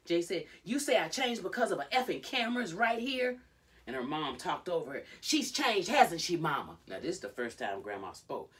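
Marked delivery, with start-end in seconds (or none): 0.6-3.4 high, louder re-enacted protest; heated accusation. Retelling Jade arguing with her mom, heated. 5.2-6.8 loud, high talking-over voice; insistent. Retold or imitated. Christy appealing to Grandma over Jade, loud and insistent.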